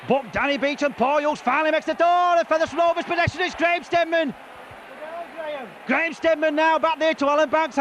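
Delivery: excited rugby commentary, rising; live play-by-play. Real, not acted. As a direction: call the match live.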